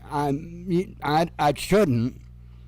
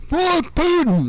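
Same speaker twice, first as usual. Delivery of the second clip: much higher, louder defiant battle cry; mock Prigozhin yell; 13 semitones higher, 3 dB louder than usual. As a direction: yell it like a charging rebel. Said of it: "Prigozhin's like".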